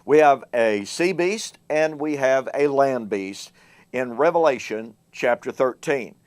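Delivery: ordinary talk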